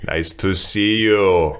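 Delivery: put-on slow, heavy Austrian-accented English; a light Arnold voice, slowly pushing out each word; Arnold-style Austrian English. Repeating the villager's line as a light Arnold impression, slow. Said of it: "It was Arnold".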